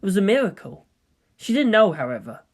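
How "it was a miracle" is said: usual speaking voice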